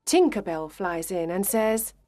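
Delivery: ordinary delivery, nothing marked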